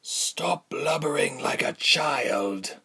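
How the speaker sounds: coarse, whispery villain, drawn out; slow, breathy, rough menace. A narrator's reading. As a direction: scold slowly in a coarse half-whisper. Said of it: "said slowly and coarsely".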